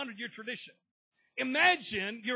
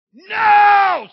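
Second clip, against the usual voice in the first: sudden loud, high shouted "No!"; screaming no; 13 semitones higher, 23 dB louder than usual. Retold or imitated. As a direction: explode out of the chair with it.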